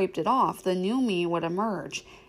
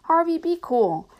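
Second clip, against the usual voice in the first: put-on 1 young girl voice; 9 semitones higher, 4 dB louder than usual. A narrator's reading.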